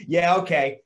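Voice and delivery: a hint of a smug buddy; knowing mock agreement. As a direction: humour him like he'll learn. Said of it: "He goes".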